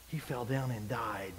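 a grave, hushed, slow reading. Acted. Dramatic climax of scripture story.

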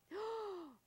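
sharp high gasp of delight; excited gasp. Real, not acted. Host spots the ring on live TV, gasping high. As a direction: gasp like you just spotted treasure.

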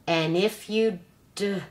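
slow, broken, dying robot voice; quiet garbled voice. Acted. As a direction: grind the words out as he fails. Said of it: "in a quiet, garbled voice".

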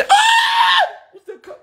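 sudden high startled yell as the dog jumps; startled shout. Real, not acted. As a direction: cry out in surprise, half laughing. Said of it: "Grité". (grito de sorpresa, riendo)

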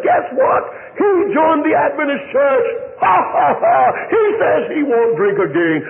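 high, jeering cackle through the taunt; mock him with a shrill ha ha ha; mocking laugh. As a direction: girlfriend sneering to the room, voice leaping high. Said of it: "laughed mockingly".

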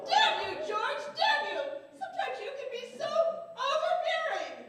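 very loud, high outburst; furious shouting. Acted. Wife cursing her husband mid-quarrel, furious shout. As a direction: explode at full volume.

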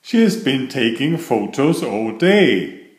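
ordinary delivery

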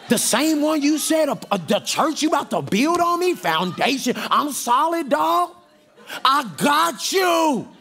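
high, cocky shouted boasting with laughs; zealous Peter. Acted. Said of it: "he was like".

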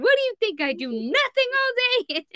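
high-pitched mock indignation; Dan. Cohost imagining Dan's clip on a soundboard, high mock-irate mimicry. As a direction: squawk his outburst as a caricature. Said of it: "it's gonna be Dan".

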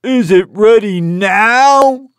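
high, slow eager donkey; impatient donkey. A narrator's reading. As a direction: beg for it, high and drawn out. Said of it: "asked Donkey".

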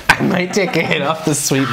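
ordinary talk, nothing marked

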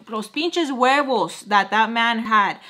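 usual speaking voice

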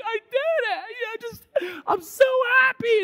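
very high, tearful victory cry; hammed-up happy wail, building to a shout; overjoyed mock crying. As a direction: imitate a champion sobbing with joy. Acts overjoyed athlete weeping after win.

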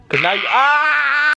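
sentence cut off by a sudden cry; surprised cry; startled yelp. Real, not acted. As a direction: yelp as she strikes. Toddler hits him mid-sentence.

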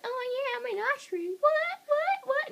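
high, cocky ostrich voice. Acted. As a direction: strut it like a boss ostrich. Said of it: "and he was like".

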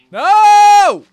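loud, high shriek of no; anguished shout. Real, not acted. Gamer losing something, shrieked no. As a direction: scream it in sudden horror. Sudden in-game disaster.

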